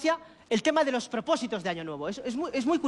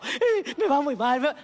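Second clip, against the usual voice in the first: mock overwhelmed; slower than usual. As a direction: fret as if the whole night is ruined. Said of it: "vas toda la noche agobiado".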